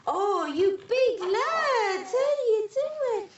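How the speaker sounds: high, drawn-out amazed cooing; delighted marvelling. Real, not acted. Midwife to hefty newborn, delighted amazement.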